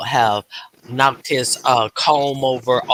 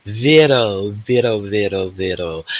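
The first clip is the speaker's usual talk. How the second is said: singsong name call; 4 semitones lower, 4 dB louder than usual. Shown as a demonstration. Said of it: "I always say her name like that".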